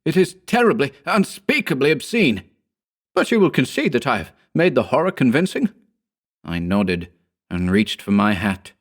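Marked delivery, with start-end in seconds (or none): 0.0-2.4 excited. A narrator's reading. Author gloating over horror. 3.1-5.7 excited. A narrator's reading. Author eager for approval.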